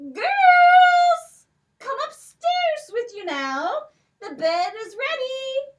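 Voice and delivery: very high, loud sing-song calling; giant's wife calling upstairs. Acted. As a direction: call the girls up sweetly.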